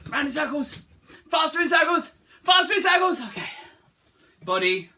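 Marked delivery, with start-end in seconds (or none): 0.1-3.2 high, loud shouted push; loud faster call. Real, not acted. Teacher urging children to circle faster, loud. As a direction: hype them to go faster.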